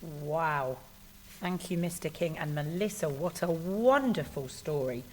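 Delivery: usual speaking voice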